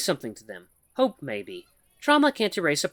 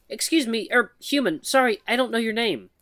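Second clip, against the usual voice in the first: higher, halting nervous voice of a shy mouse; timid mouse woman; 10 semitones higher, 4 dB louder than usual. Acted. Shy mouse woman nervously calls to the human, halting and high. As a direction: stammer it out like a timid mouse calling for attention.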